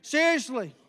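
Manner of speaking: ordinary talk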